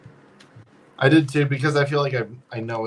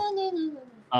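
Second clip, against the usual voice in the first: mock protest; 4 dB quieter, much faster than usual. Real, not acted. Playful protest at co-host.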